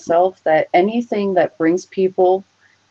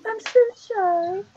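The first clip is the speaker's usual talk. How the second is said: high, slow, soft mock-coy voice; tiny shy-girl voice, teasing; 5 dB quieter, slower than usual. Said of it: "She's like, all like".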